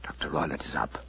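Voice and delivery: breathy whispered exchange. Acted. Holmes and Watson whispering while waiting in the dark. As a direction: whisper as if the killer is next door.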